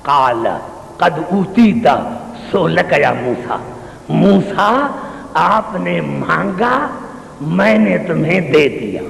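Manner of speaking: low, slow and gentle, God speaking with love; lovingly spoken. Acted. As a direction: soften right down and say it tenderly. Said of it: "بڑے پیار سے کہا". (نرمی اور پیار سے، دھیمی آواز میں)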